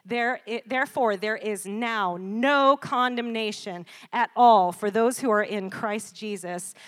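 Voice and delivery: loud, slow preaching of scripture; scripture reading. Acted. Preacher reading Romans eight at full force. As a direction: proclaim it to the congregation.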